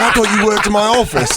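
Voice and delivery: high, amazed London stranger; London accent. Retold or imitated. Stranger mistook actor for coworker, amazed London accent. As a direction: imitate a Londoner realising his mistake. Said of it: "I was in London".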